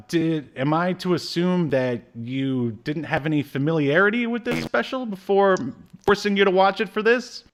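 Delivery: ordinary talk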